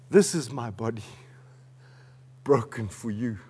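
low, soft voice with long pauses; solemn, hushed preaching. Real, not acted. Preacher quoting Jesus at the Last Supper, solemn and hushed. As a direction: speak it reverently, letting each phrase settle.

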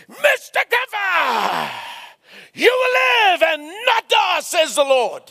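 shouted proclamation; proclaiming shout. Retold or imitated. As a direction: declare it over him with full force. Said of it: "and I shouted".

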